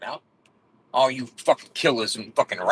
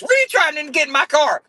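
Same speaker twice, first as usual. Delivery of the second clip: much higher imitated confrontation; mock angry woman; 4 dB louder than usual. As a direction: squeak it like the suspicious car owner. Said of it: "she goes".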